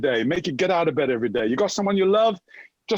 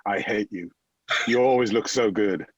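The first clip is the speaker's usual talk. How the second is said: mirror's voice; 6 semitones lower than usual. Retold or imitated. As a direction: voice the mirror sneering back at him. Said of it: "the mirror says".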